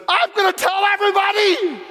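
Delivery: high loud joyful vow; jubilant shout. Acted. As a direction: burst out with joy.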